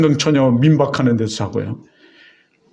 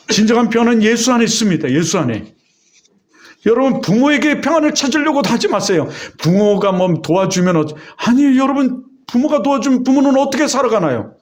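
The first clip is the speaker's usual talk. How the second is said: much higher, urgent preaching to the congregation; fervent preaching; 8 semitones higher than usual. Acted. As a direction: drive the blessing home like a revival preacher. Preaching at full force.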